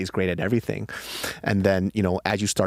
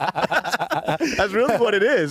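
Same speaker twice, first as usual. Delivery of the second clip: laughing; 13 semitones higher, much slower than usual. Real, not acted. Laughing joke.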